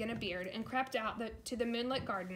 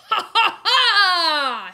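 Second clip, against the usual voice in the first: loud, high booming laughter; roaring giant laugh; 9 semitones higher, 20 dB louder, much slower than usual. A narrator's reading. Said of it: "he roared".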